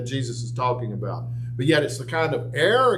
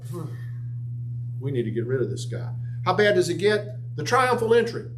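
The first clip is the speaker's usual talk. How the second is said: fired-up preaching; 7 semitones higher than usual. Acted. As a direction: preach it with rising fire, voicing the crowd. Preaching crowd scene.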